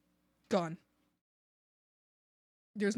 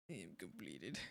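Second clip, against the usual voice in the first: much lower and softer, a put-on reverent tone; low mock solemn voice; 18 dB quieter than usual. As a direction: intone it like a fulfilled creature. Odd low voice.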